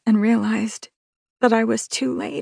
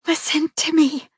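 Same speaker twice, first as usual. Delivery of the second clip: higher, pleading voice; 8 semitones higher than usual. Acted. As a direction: beg him to listen. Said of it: "I pleaded".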